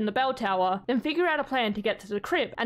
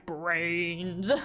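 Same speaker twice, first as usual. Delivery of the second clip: low, softer zombie groan on Brains; mock zombie moan; 6 semitones lower, 3 dB quieter than usual. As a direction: moan the word like a hungry zombie. Imitating the zombies she hears.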